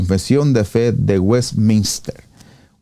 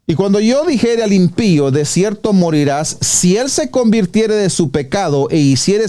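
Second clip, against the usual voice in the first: scripture reading; 5 semitones higher than usual. Acted. Reads Ezequiel verse aloud.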